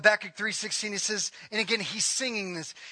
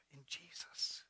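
breathed whisper, much softer; 8 semitones lower, 20 dB quieter, slower than usual. Acted. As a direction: whisper the name like a secret. Closes the verse in a hush.

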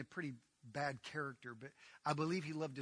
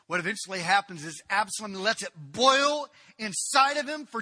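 loud, high, building sermon delivery; fiery preaching; 9 semitones higher, 16 dB louder than usual. Acted. Preacher tells the congregation of Absalom's revenge, full-force preaching. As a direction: preach the story with rising intensity.

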